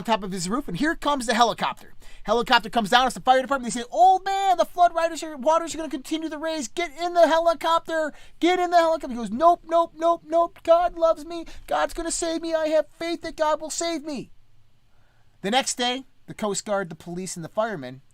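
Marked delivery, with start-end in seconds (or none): 3.9-9.1 high, raised call from a helicopter; urgent shout over the rotor noise. Retold or imitated. 9.3-14.3 high, insistent refusal, lightly voiced; stubborn old man.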